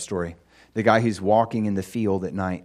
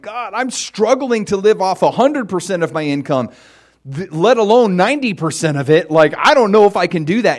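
higher and louder, pleading with God; anxious plea; 8 semitones higher, 9 dB louder than usual. Pretend. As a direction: pour out the worry to God, getting louder. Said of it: "it's like".

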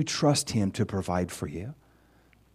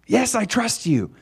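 much higher, slow eager reply; eager answer; 10 semitones higher than usual. Pretend. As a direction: answer God high and drawn out. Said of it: "Do you trust me".

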